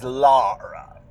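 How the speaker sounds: lightly voiced old witch on "Lara"; a hint of haughty witch; Old Widow Holcomb. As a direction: draw out "Lara" a little, in character. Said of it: "that's how Holcomb's voice is. She always says".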